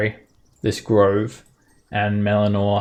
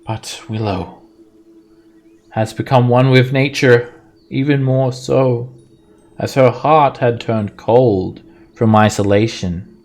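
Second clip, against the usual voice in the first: loud, higher, solemn spirit voice; forest spirit; 3 semitones higher, 8 dB louder than usual. Acted. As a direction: speak like an ancient sorrowful tree god. GM voices the tree demigod.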